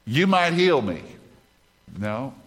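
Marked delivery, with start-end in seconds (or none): 0.0-1.0 high, loud, falling line; loud misreading. Shown as a demonstration. Preacher deliberately misquotes the verse to the congregation, loud and high. Wrong reading, corrected right after.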